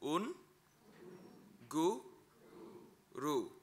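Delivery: "un, gu, ru" split into separate syllables with pauses. Shown as a demonstration. Teacher sounding out "un, gu, ru" for learners. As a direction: break "un, gu, ru" into slow single syllables.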